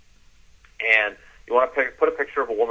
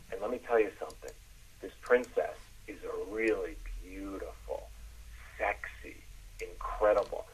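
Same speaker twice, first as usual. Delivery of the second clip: low storytelling; 3 semitones lower, 10 dB quieter than usual. Shown as a demonstration. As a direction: drop down and savor the words quietly. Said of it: "I want you to get lower in it".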